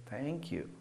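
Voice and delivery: very soft, low "Thank you"; almost silent thank you; barely audible thanks. Shown as a demonstration. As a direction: breathe out a faint thanks. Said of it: "there's a different emotion behind it".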